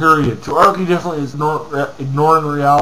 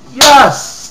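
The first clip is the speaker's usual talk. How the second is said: excited cheer; 9 semitones higher, 7 dB louder than usual. Real, not acted. Cheering a comment he agrees with.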